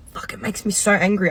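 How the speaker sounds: ordinary talk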